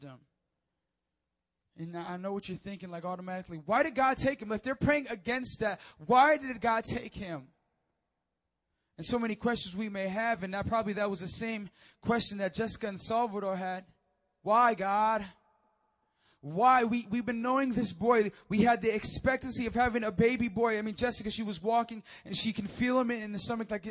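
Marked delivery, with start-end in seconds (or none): 3.7-7.5 raised, higher-pitched challenge; demanding why, voice lifted; insistent questioning. Acted. 14.4-20.8 loud, lifted plea to God; grieving demand, preached loudly; anguished questioning. Acted. Voices grieving parents questioning God.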